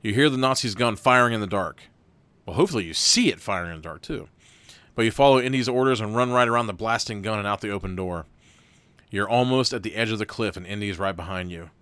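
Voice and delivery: lively game master narration; story narration. Acted. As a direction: read the scene to the players like a game master. Reads the gamebook aloud.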